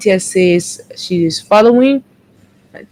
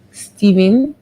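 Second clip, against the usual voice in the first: normal talking voice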